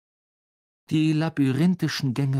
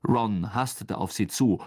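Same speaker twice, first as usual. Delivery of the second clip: ordinary delivery, nothing marked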